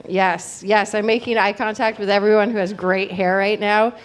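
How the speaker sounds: normal talking voice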